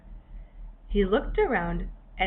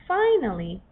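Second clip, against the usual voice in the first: ordinary delivery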